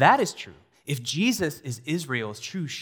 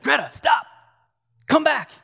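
put-on high, loud panicked shout at a toddler; panicked yell; 10 dB louder than usual. Father yelling at his daughter near traffic, panicked shout. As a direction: yell it like a scared parent. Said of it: "I didn't need to yell".

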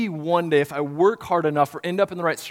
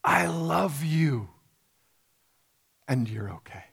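tender whisper; 2 semitones higher than usual. Acted. The Father speaking to believers, in a tender whisper. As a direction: speak it gently like a loving father. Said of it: "hear the Father's words".

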